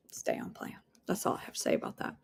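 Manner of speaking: hushed, unvoiced whisper; whispered aside. Real, not acted. Coach reminding listeners to stay on plan, whispered. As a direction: whisper it as a pointed last word. Whispered repeat of her advice.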